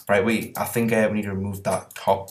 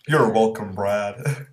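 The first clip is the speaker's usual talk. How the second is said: a hint of a put-on American guy; light American-accented impression; much slower than usual. Answering Brad's thanks as his co-player, lightly American accent. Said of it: "That was my impression of you".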